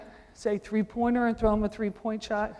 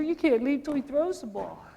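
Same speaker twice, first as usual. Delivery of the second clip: ordinary delivery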